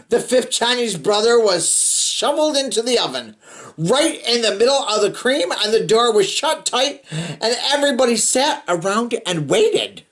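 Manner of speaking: put-on steady read-aloud narration for kids; storybook reading. A narrator's reading. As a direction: read it like a bedtime picture book. Reading picture book to children.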